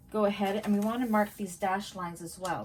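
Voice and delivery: ordinary talk, nothing marked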